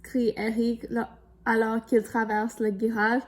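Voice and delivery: lively storybook read-aloud; reading to children. A narrator's reading. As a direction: tell it brightly to young listeners. Reads picture book to children.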